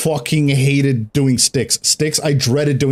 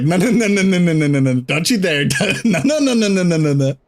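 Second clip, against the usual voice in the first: laughing mock protest; 4 semitones higher than usual. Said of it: "Sky would be like".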